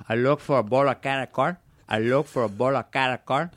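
put-on Tony Montana's Cuban accent; swaggering Scarface-style Cuban accent. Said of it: "that accent saying cara corn".

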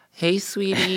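put-on high, cutesy little-kid voice; baby voice. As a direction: talk like a small child. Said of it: "If I talked in a baby voice to Amir".

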